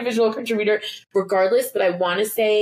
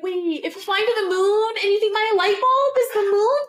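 high, sassy voice to a moth; to a moth on the windowsill, high and sassy; talking to a moth; 14 semitones higher than usual. Retold or imitated. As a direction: tell the moth off, high and cheeky.

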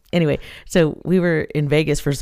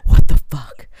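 shocked; 3 semitones lower, 10 dB louder than usual. Retold or imitated. Waking from dream, shocked.

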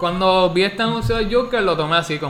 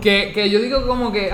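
ordinary talk, nothing marked

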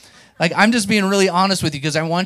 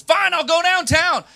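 high, loud, drawn-out yell of giving in; exasperated scream; 6 dB louder, slower than usual. Retold or imitated. Young preacher screaming at God in his office, exasperated. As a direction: scream your grudging surrender to the ceiling. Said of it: "I remember screaming in my office".

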